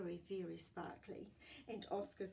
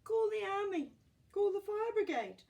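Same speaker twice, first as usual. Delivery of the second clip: high, loud frantic shout; panicked shouting; 13 dB louder than usual. Acted. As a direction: yell for help in panic. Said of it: "Somebody says".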